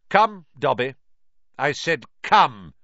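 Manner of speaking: sharp command. Acted. Lucius ordering his elf sharply.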